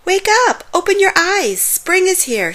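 put-on high, eager children's shout; storybook kids' excited wake-up cry. A narrator's reading. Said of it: "jumped on the bed and shouted".

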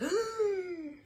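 high, drawn-in gasp of recognition; exaggerated high gasp; mock shocked gasp. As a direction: gasp as if suddenly recognising someone. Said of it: "until you're like".

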